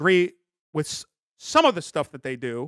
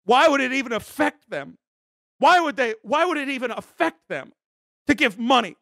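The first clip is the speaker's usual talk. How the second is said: indignant outrage; 5 semitones higher than usual. Real, not acted. Indignant complaint about CCP.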